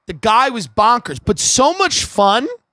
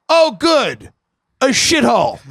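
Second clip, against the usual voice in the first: mock snooty sneer; 4 semitones higher than usual. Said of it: "takes a quick look around and goes".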